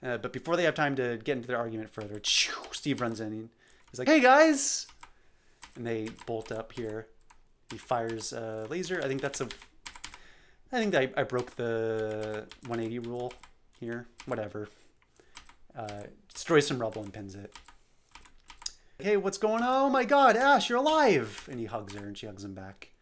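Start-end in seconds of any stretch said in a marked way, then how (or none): 4.1-4.9 very high, loud bright greeting; cheery hello. Acted. Steve bursts in and greets them, cheerily loud. 19.0-21.5 high, loud joyful cry; thrilled to see her alive; overjoyed surprise. Acted. Steve discovers Ash survived, overjoyed and loud.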